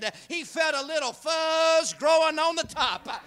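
building to a high, loud shouted climax; full-force preaching. Acted.

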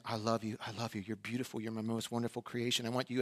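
soft, low, hushed love; tender voice of God. Retold or imitated. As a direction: whisper it like a tender promise. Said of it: "you hear him saying".

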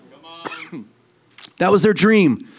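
normal talking voice

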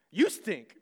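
mock childish snap back; a hammed-up grade-school comeback; childish retort. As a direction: fire it back like a sulky kid. Childish comeback to wife's tease.

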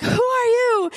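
high, drawn-out disbelieving question; astonished husband. Retold or imitated. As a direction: imitate someone baffled by who just walked in. Retells husband failing to recognize her.

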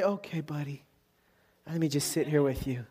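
gentle comforting. Acted. Comrade to an injured soldier in the ditch, acted gentle comfort. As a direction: ease down and soothe a worn-out friend.

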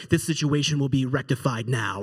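low, stern superhero dad; deep-pitched mock-heroic resolve; dad mode. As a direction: imitate a father taking command. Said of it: "dad mode just happens".

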